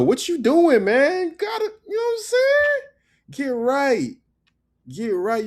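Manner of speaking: very high, loud, hammed-up voice; mock squeaky plea. Teacher hams up begging viewers to subscribe. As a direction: squeal at the viewers to get right.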